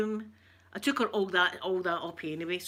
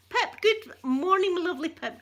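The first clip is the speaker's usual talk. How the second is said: high doting hello to a viewer; sweet high greeting; 10 semitones higher, 5 dB louder than usual. Real, not acted. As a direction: coo a good morning to a friend. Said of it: "greeting viewer Pip warmly".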